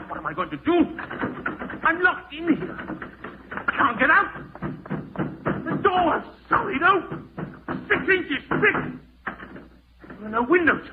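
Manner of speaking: high, loud, frightened cries; rising panic. Acted. Ramsay locked in the airless vault, high panicked cries. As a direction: voice climbing in terror as the walls close in.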